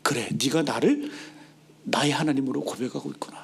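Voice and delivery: low, soft, unhurried voice; tender approval. Acted. God gladly receives David's confession, tender and low. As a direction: let gladness settle into gentle words.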